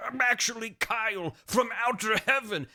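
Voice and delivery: high, strained character voice; hammed-up high-pitched dying man; Kyle, dying reveal. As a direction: gasp out a mock deathbed confession. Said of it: "he goes".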